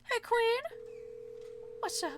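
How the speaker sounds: high, bright call to a friend; excited high greeting. Real, not acted. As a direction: sing out a happy hello.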